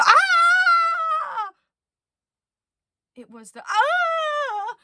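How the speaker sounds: high loud shriek of alarm; a startled cry cut short; sudden yelp. Acted. Penelope slips in the dark, high startled shriek. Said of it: "that bothered them".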